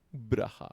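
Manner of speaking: "braja" with the b straight into the r; "braja" said without any vowel between b and r; sheva left silent. Shown as a demonstration. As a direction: squash "braja" into two syllables. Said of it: "No decimos braja".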